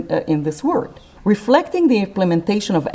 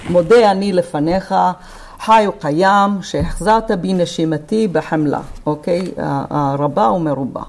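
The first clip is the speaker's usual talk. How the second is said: Hebrew prayer in Iraqi accent; fast Iraqi-accented Hebrew recitation; much faster than usual. Shown as a demonstration. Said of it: "I'm reading it in a Iraqi accent".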